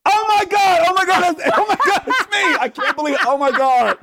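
shrieking high with joy; overjoyed squeal of disbelief; dawning joy. Real, not acted. Guest guesses host's own name.

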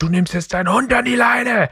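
retold outburst, shouted at the dog owner; near shout. Telling off a dog owner, shouted. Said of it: "also ich hab fast geschrien".

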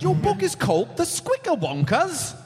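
lilting Scottish brogue; Scottish accent. Pretend. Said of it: "only try Scottish".

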